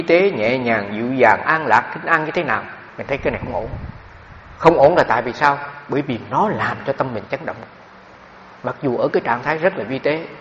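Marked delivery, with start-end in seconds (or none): none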